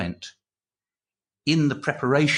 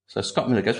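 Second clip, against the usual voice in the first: usual speaking voice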